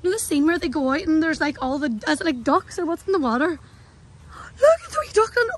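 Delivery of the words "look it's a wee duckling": high, louder delighted coo; excited cooing. Real, not acted. Girl sees a duckling by the water, cooing excitedly.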